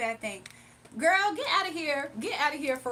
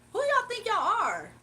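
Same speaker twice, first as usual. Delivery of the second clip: sassy challenge; 7 semitones higher, slower than usual. Real, not acted. Challenging rude commenters.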